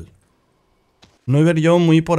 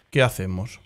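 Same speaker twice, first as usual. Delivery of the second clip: usual speaking voice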